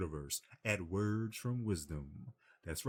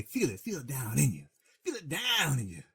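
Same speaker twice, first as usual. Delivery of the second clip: higher, urging voice pushing him on; coaxing client; 6 semitones higher, 3 dB louder than usual. Retold or imitated. Cuba Gooding Jr. coaching Jerry over the phone, urging. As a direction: coax him to feel it, pitched up. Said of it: "Cuba Gooding Jr. is on the phone telling you".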